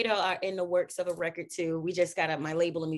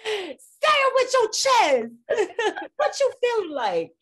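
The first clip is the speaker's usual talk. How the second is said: high, loud, slow sassy call; much higher and louder, drawn out with a laugh; hyped catchphrase; 13 semitones higher, 8 dB louder, slower than usual. Shown as a demonstration. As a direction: belt it out like a hype line. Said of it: "repeats catchphrase, much higher, louder".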